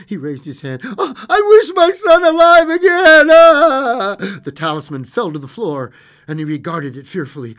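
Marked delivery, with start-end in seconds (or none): 1.0-4.1 very high, full-voiced scream; anguished wailing cry. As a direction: scream the wish out in agony, drawing out the end. Desperate wish on cursed talisman.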